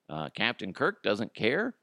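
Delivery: normal talking voice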